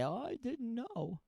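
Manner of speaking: high, soft, drawn-out excuse; mock innocence. Host imitating a careless tourist's excuse, mock innocence. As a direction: feign innocence in a small high voice. Mocking a culprit's feeble excuse.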